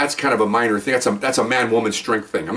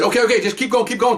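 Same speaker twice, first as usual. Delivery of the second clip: urging; 8 semitones higher than usual. Retold or imitated. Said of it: "So I said".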